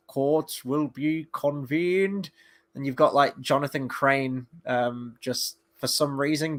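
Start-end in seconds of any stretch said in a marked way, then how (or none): 0.1-2.3 slow, solemn courtroom judge; stern judge voice. Retold or imitated. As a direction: announce it slowly like a judge. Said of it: "the courtroom for that".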